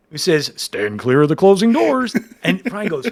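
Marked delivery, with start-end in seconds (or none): 0.6-2.1 loud, raised subway announcement voice; subway announcer. Retold or imitated. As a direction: imitate the train's door announcement, projected. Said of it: "is the voice of the M T A subway".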